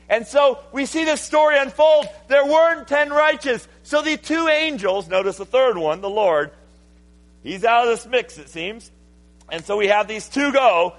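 high, loud, driving sermon delivery; full-force preaching. Real, not acted. Preacher retelling Lot's story to the congregation, full-force preaching. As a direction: preach it out loud and high, stretching the key words.

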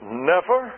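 "Never!" thrown out as an exclamation, rising; exclaimed never. Shown as a demonstration. Preacher giving Spurgeon's sermon title, exclaimed. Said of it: "Exclamation point".

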